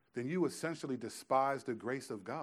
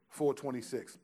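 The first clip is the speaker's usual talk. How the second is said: normal talking voice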